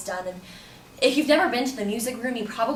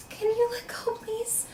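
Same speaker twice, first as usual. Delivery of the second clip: much higher, small pleading voice; retold nervous request, trailing softer; uneasy plea; 13 semitones higher, 4 dB quieter, slower than usual. Student to the man grabbing her, uneasily pleading.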